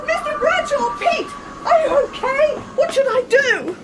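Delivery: high, alarmed calling; worried, pitched right up; panicked concern. Acted. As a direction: cry out to him in panic. Alarmed colleague sees him trapped.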